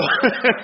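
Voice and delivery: a quick real chuckle; short laugh. Real, not acted. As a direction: laugh at the punchline. Laughs at his own punchline.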